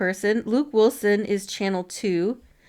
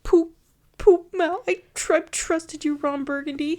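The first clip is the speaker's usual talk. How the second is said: higher, hurt mock whimper; upset Garth; 6 semitones higher than usual. Retelling Garth's heartbreak to her co-host, mock upset. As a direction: play the betrayed sidekick, whiny. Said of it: "Garth is real upset. He's like".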